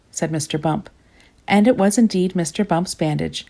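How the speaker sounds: ordinary delivery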